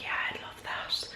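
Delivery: soft breathy whisper. Retold or imitated. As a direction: whisper it like a secret. Retold whispered reply to John.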